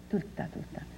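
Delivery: breathy whispered reassurance, no voice at all; soft whisper repeating the promise. Retold or imitated. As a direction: breathe it out like a gentle secret. Sainte-Anne's reassuring promise, retold.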